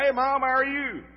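a sunny retold hello into the phone; cheery hello. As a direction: greet mom like all is well. Cheery greeting answering the phone.

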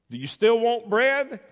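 loud, high call; calling after. Acted. Baker calling to the walking-away beggar, loud and high. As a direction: call him back eagerly.

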